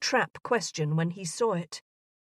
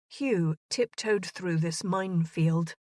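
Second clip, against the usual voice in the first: usual speaking voice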